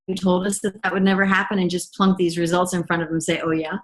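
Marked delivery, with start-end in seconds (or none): 3.4-3.8 a smug "oh, yeah" dissolving into a breathy laugh; gloating laugh. Pretend. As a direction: rub it in, then laugh. Imagined gloating to the doctor.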